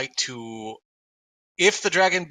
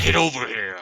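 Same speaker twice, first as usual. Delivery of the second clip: deep growly voice. Shown as a demonstration. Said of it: "Can you do it in a deep, growly voice?".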